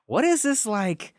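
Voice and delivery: incredulous. Retold or imitated. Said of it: "we were like".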